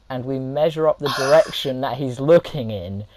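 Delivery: usual speaking voice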